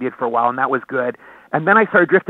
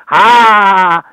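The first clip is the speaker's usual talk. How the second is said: single long, loud "ha"; signature one-note laugh; 3 semitones higher, 9 dB louder than usual. Shown as a demonstration. Comedian demonstrates the laugh that always got laughs, one-note laugh. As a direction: belt one sustained comic "ha" in one breath. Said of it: "the one laugh always would get laughs".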